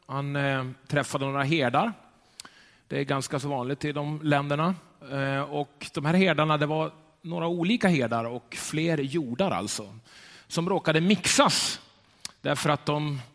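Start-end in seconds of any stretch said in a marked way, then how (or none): none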